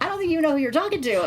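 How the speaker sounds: high-pitched defiant comeback; retold indignation. Woman retelling her warning to a fake officer, indignant high pitch. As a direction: replay telling off a scammer, voice lifted high.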